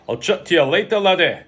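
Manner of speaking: loud, high, commanding Scot; rough Scottish accent. A narrator's reading. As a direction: call it out as a gruff Scottish boss. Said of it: "Scottish, slightly rough".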